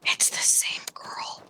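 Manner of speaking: whispered, slow aside. Real, not acted. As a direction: whisper it slowly. Quiet aside in chat.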